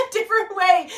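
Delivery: through a laugh. Real, not acted. Laughs mid-sentence.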